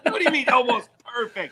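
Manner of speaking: high, offended squawk through laughter; mock indignation. Co-host echoing Lisa's elevator outrage, mock offended. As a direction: laughingly play the insulted woman.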